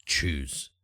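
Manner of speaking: low, curt reply; Light's low voice. Acted. Light telling Nalthor to pick a door, low and curt. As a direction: drop low and cut it short. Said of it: "And Light goes".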